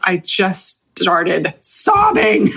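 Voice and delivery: ordinary talk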